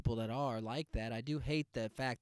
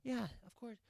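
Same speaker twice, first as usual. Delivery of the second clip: mild mock agreeing mom; 5 semitones higher, 10 dB quieter, faster than usual. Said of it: "the other woman's like".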